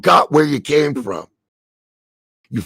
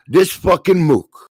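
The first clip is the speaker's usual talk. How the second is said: angry; 3 semitones higher, 4 dB louder than usual. Real, not acted. Furious rant at a debtor.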